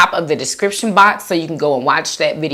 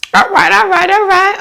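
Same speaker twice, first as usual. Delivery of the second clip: much higher, louder all rights; excited; 4 dB louder than usual. Real, not acted. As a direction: hype yourself up, all right all right. Hyped sign-off before eating.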